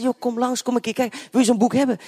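bubbling over while inviting him in; eager invitation. Retold or imitated.

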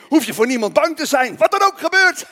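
high, rising into a loud declaration; rousing exhortation. Real, not acted. Preacher telling believers they need fear no one, rousing exhortation. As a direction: drive it home triumphantly.

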